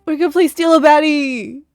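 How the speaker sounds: loud, high gleeful cheer; excited cheer. Real, not acted. Cheering the switch.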